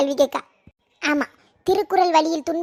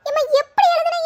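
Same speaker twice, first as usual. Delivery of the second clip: higher, scornful parroting of எப்படி எழுதுன; mocking echo; 8 semitones higher than usual. Daughter mocking her mother's exam question, higher and scornful.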